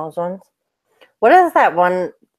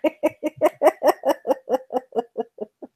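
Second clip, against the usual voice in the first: high giggle; 7 semitones higher, 4 dB quieter than usual. Real, not acted. Amused by seller's dirty items.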